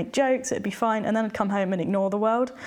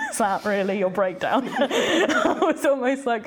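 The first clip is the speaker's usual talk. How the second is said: laughing through the words in disbelief; amused, laughing; 3 dB louder than usual. Real, not acted. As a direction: let a laugh break into the retelling. Laughs while retelling friend's worry.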